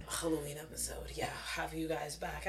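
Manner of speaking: soft breathy whisper. Real, not acted. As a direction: whisper it playfully, like a secret. Playful hushed aside, unvoiced.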